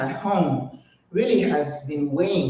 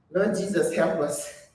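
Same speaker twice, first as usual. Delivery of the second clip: ordinary talk, nothing marked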